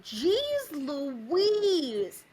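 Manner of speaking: long drawn-out exclamation; exaggerated exasperated amazement; mock astonishment. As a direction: stretch it out in playful disbelief. Retold mock astonishment at friend's twang.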